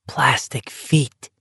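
slow breathy whisper of "Plastic feet"; "Plastic feet" whispered, drawn out. Acted. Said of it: "he whispered".

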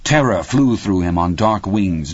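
plain reading voice

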